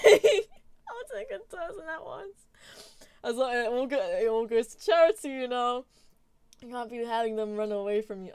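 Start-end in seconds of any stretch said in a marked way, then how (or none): 0.0-2.5 high, slow, laughing till tears; crying laughing. Real, not acted. Streamer overwhelmed by her own joke, crying laughing. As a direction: squeeze it out through helpless laughter. Laughing so hard she cries.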